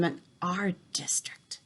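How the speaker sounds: grave whispered warning. A narrator's reading. Grandmother warns the boy of witches, in a whisper. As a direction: whisper it like a secret.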